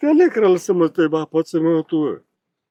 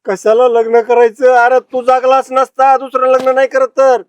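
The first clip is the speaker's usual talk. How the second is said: sustained loud, high-pitched retort; angry shout; 5 semitones higher, 7 dB louder than usual. Acted. Woman answers jibe about second marriage, angry shout. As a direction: throw the question back, shouting.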